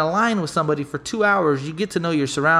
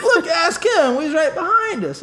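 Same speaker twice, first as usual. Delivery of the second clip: laughing; 11 semitones higher than usual. Real, not acted. Quoting guy while laughing.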